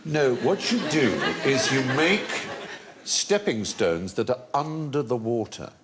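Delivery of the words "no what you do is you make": normal talking voice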